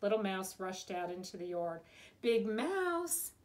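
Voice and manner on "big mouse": high, eager repeated call. A narrator's reading. Little Mouse rushing into the yard, calling out. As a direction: call the name, louder the second time. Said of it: "he called".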